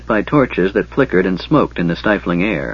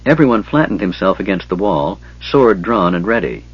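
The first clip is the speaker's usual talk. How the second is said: ordinary delivery, nothing marked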